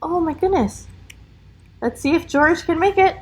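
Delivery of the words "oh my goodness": usual speaking voice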